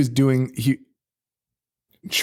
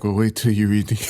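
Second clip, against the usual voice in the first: imitated character voice; 4 semitones lower than usual. Acts out the guy's line, breaks up.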